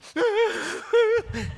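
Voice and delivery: loud, high-pitched mock wail; imitated child's sobbing. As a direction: burst into a little girl's pretend sobs. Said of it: "about a minute of her sobbing".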